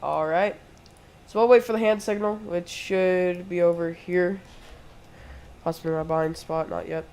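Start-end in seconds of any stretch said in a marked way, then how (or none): none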